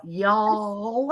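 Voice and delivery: "y'all" stretched long as one syllable; drawn-out y'all. Shown as a demonstration. Showing how she was taught it, drawn-out y'all. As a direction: draw out "y'all". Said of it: "had to spell it out".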